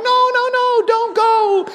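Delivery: high, pleading mock wail. Pastor pleading with graduating students not to go, a high mock wail. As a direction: whine at them to stay.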